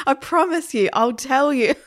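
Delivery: laughter bubbling through; laughing. Acted. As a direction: barely get it out for laughing. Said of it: "couldn't stop laughing".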